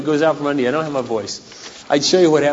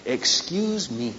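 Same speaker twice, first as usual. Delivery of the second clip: sarcastic excuse me; 8 dB quieter, much slower than usual. Acted. Sarcastic retort, slow.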